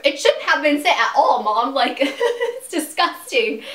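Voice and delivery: loud, disgusted retort at the mom; a disgusted loud protest. Retold or imitated. Telling mom the letter should never have been sent, disgusted and loud. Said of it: "I'm like".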